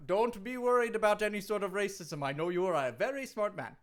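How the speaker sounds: hammed-up Jamaican accent; Kofi Kingston, Jamaican accent. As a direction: greet them in a mock Jamaican lilt. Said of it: "it sounds like you have".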